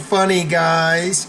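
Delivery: high, forced nervous chuckle, words drawn out; put-on people-pleasing giggle; fake overly nice. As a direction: laugh it off weakly to placate a bully. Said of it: "you pretend you're overly nice".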